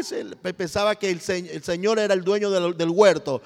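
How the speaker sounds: normal talking voice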